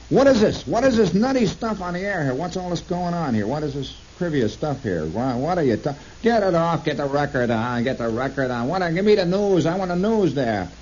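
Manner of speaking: mock outraged New York caller; indignant callers. New Yorkers phoning the radio host to complain, mock indignant. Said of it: "they would be very indignant".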